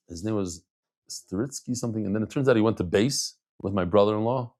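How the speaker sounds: ordinary talk, nothing marked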